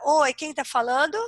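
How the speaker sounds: ordinary talk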